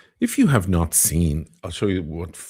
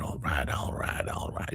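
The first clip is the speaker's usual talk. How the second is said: much lower, whispered "all right, all right, all right"; McConaughey low voice; 4 semitones lower, 8 dB quieter than usual. Retold or imitated. Impersonating McConaughey, low and whispered. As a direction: drop the voice way down, soft and breathy like McConaughey. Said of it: "He would bring his tone down".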